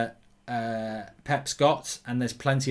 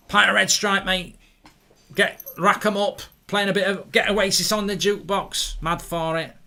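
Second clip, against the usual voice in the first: higher, louder Mancunian lad act; Manchester accent; 5 semitones higher, 4 dB louder than usual. Retold or imitated. Teenage self ordering at the pub, Manchester accent. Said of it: "used to speak with a Manchester accent".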